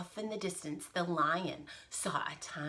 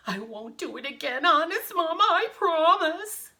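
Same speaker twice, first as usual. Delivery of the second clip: rising, hammed-up plea; high, loud begging at the end; pleading giant son; 8 semitones higher, 8 dB louder than usual. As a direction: promise Mama like a scolded boy. Scolded giant pleads with his mother.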